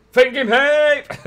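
high, loud cheer; hype shout. Real, not acted. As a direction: holler it like a fan. Cheering for fighting games.

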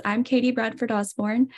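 ordinary talk

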